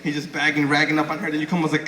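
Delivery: usual speaking voice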